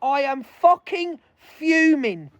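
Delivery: ordinary talk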